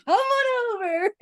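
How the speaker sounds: sing-song mock welcome, laughing; inviting ad voice. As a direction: pitch it up and stretch it like a cheesy ad. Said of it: "then it'll be like".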